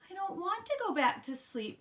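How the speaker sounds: put-on high, whiny kid-frog complaint; crying protest. A narrator's reading. Froggy refusing his mother's order, high whiny protest. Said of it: "cried Froggy".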